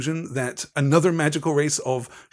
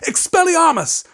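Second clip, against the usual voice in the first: high, loud spell cry; shouted; 7 dB louder than usual. A narrator's reading. Harry lost his temper and fires a spell, shouting. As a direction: shout the spell at full pitch. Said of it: "He pulled out his wand and shouted".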